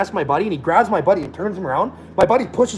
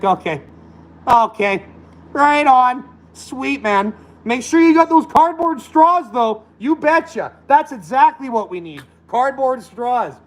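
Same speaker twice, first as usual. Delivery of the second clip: high, sarcastic fake cheer; sarcastic mock agreement; 8 semitones higher, slower than usual.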